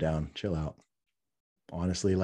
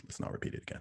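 whispering; 14 dB quieter, much faster than usual. Real, not acted. Pleading aside to listeners.

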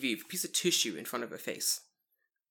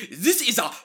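mock Japanese accent, loud and slow, the P exploded hard; loud slow "This is a pen!" with a hammered pen; bad Japanese English; 8 semitones higher, 10 dB louder, slower than usual. Imitating the TV woman to her audience, loud mock accent. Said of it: "very bad English".

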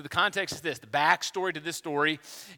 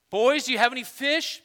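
high, louder mock-familiar call; calling little boys; 8 semitones higher, 6 dB louder than usual. Jesus asking the fishermen as little boys, mock familiar call. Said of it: "like him calling them little boys".

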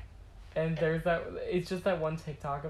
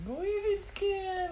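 high, very slow Staten Island parody; mock Staten Island accent; much slower than usual. Teasing co-host's old speech, drawn-out Staten Island accent. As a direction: stretch out a squeaky Staten Island imitation.

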